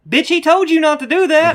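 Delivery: high, loud heckle from the background; imitated scolding yell. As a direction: yell it like a kid scolding his buddy. Friend yells from background, acted out.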